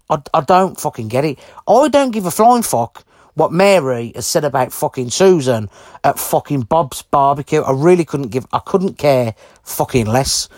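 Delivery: ordinary talk, nothing marked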